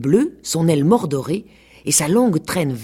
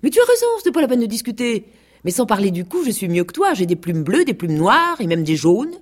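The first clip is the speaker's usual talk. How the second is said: higher, mocking boast; insolent sneer; 6 semitones higher than usual. A narrator's reading. Vexed rooster boasting to the goose, insolent and sneering. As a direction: start fast and high, then settle into cocky bragging. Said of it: "ricaner et dit avec un air insolent".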